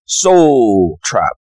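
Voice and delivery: Soul trap with a South African accent. Shown as a demonstration. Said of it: "I'll try to do the South African accent".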